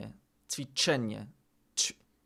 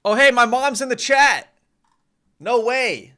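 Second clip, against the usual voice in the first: high, loud delighted squeal of recognition; thrilled to spot mom in chat; real burst of excitement, high and loud; excited surprise; 9 semitones higher, 8 dB louder than usual. Real, not acted.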